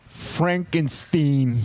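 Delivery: Frankenstein said slowly, ending "steen"; pronounced Frankenstein. Shown as a demonstration. Host shows the beer name's pronunciation, slow and drawn out. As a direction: draw out Frankenstein with a long "steen". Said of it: "It's pronounced".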